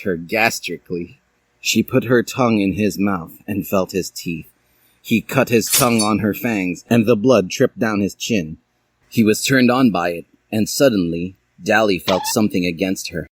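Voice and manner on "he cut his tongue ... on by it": plain reading voice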